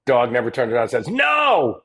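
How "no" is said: high bratty no; defiant child shout. Retold or imitated. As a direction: shout it like a kid.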